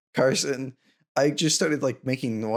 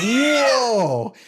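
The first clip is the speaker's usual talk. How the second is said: surprised whoa; 6 semitones higher than usual. Retold or imitated. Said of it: "And I was like".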